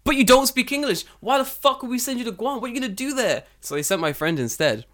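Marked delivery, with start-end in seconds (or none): 0.0-3.4 squeaky indignant boss voice, much higher; mocked boss rant. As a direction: ham up the boss's scorn.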